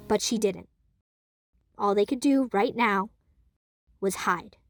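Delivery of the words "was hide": usual speaking voice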